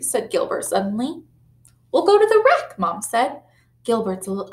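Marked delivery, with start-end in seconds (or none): 1.9-2.8 higher, louder treat news; spring a happy surprise; happy announcement. A narrator's reading. Mom telling her son about a treat, higher and louder.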